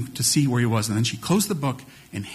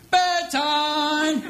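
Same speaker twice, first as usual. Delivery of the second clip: playful announcement; 13 semitones higher than usual. Retold or imitated. Sneaking up to announce bedtime.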